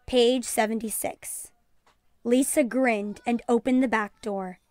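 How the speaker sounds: usual speaking voice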